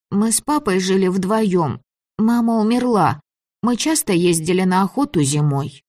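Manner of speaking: put-on high child voice, sad; a lonely boy missing his father; sad little boy.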